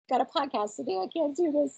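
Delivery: high, soft words through giggles; real helpless laughing. Real, not acted. Sick podcaster jokes she can't go on, laughing helplessly. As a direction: squeeze it out softly between laughs.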